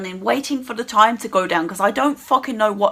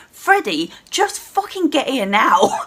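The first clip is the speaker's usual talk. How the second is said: normal talking voice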